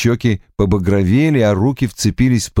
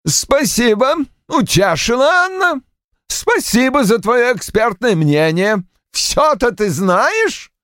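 sarcastic; 13 semitones higher than usual. Acted. Roy mocks his daughter.

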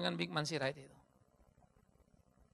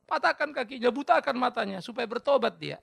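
slightly raised, petulant praying; mock vengeful prayer; 7 semitones higher, 6 dB louder than usual. Believer praying for enemies' harm, mock earnest. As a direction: pray like a spiteful believer. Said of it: "Kalau kita berdoa kan gitu".

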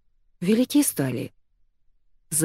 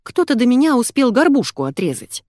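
higher-pitched indignant protest; aggrieved, raised-voice objection; 7 semitones higher, 4 dB louder than usual. A narrator's reading. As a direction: defend yourself with some outrage. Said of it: "возмутилась Маргоша".

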